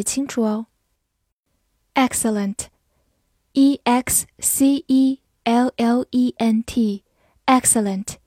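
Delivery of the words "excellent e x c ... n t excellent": slow letter-by-letter spelling. Shown as a demonstration. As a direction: spell it out slowly. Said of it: "spelling demo".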